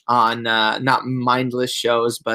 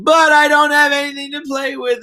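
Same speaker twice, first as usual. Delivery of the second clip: much higher and louder, a mock whining kid; imitating whiny child; 12 semitones higher, 5 dB louder than usual. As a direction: whine like a little boy on the edge of tears. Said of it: "then he starts crying".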